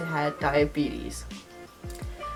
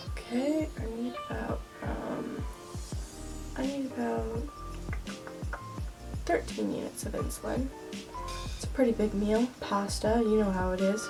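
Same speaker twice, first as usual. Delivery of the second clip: quiet; 2 semitones higher, 8 dB quieter than usual. Acted. Quiet restaurant insulin scene.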